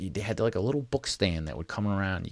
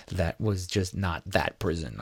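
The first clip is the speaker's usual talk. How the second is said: ordinary talk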